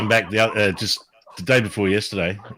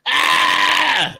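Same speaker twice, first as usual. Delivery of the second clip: high mock shriek of fright; short imitated scream; retold scream. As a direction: yelp it like a startled woman. Jane screaming at the duck.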